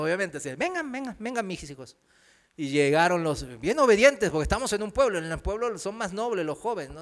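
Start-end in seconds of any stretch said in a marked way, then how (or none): 0.6-2.0 grandmotherly coaxing. Retold or imitated. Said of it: "lo ves como su nieto".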